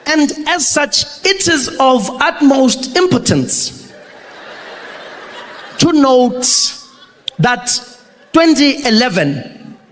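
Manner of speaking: slow, halting speech with odd pauses; Jacob Zuma speech. Retold or imitated. Zuma reading a party speech, slow and halting. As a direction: read it like a proud politician mangling the script. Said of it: "Comes out there, confident, cadence".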